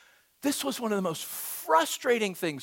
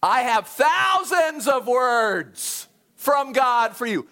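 booming proclamation; 3 semitones higher, 7 dB louder, slower than usual. Retold or imitated. Prophet booming announcement.

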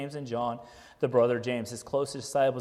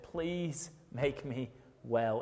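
quiet plea; 5 semitones lower, 9 dB quieter, much slower than usual. Acted. Woman begging to be healed.

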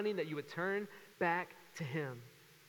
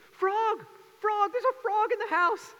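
much higher, loud mock kid shrieks; kids screaming; 9 dB louder, slower than usual. Kids finding a frog indoors, screaming high and loud. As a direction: shriek it like panicked children. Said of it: "I hear them screaming".

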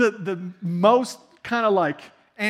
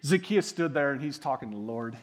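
usual speaking voice

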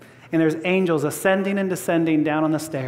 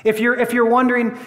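ordinary talk

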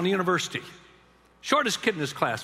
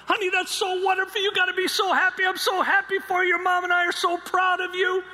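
much higher, louder happy praise; overjoyed praise; 14 semitones higher, 5 dB louder than usual. Retold or imitated. Father praising daughter on phone, high and loud. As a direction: pour out joy for her.

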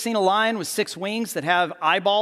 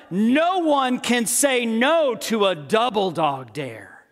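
higher, slow grand narration; movie narrator; 5 semitones higher, slower than usual. Acted. Movie narrator on the ultimate dare, slow and grand. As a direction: stretch it out like an astonished narrator. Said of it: "then the narrator says what".

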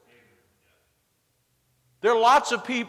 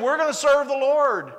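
higher, louder mock voice of the people; mock pious crowd; 8 semitones higher, 7 dB louder than usual. Preacher mocking people who keep idols, higher mock voice. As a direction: parody their empty promise.

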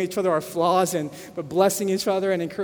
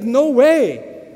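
loud, higher burst of disbelief; shocked disbelief; 4 semitones higher, 7 dB louder than usual. Retold or imitated. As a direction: blurt it out in shock. Pastor retells man's shocked reply.

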